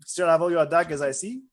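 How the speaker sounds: put-on hesitant wizard in a loose French accent; awkward small talk, faked French accent; Francois. Wizard eyeing colleague's daggers, awkward in a mock-French accent. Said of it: "This is a Francois accent".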